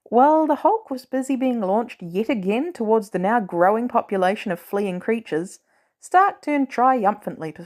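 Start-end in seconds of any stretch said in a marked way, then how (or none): none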